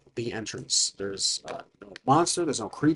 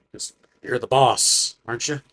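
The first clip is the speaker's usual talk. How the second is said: ordinary talk, nothing marked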